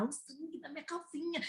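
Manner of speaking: imitating her own hushed confession; hushed secret. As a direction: say it so it is barely heard. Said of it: "Eu falando baixinho pra ele me escutar".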